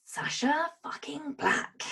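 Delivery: breathy whispered name, slow. Real, not acted. Whispering her own name as Daniel introduces her. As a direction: whisper the swear like a secret.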